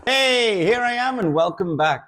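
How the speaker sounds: bright upbeat hello, pitched high; cheerful greeting. Real, not acted. Vlog greeting.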